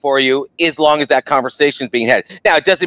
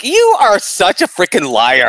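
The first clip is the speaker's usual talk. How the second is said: higher, slow, drawn-out call-out; exaggerated disbelief; mock accusation; 6 semitones higher, slower than usual. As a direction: stretch out a playful accusation. Acted accusing reply to a denial.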